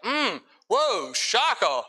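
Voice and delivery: mock surprise. Mocking surprise nobody would feel.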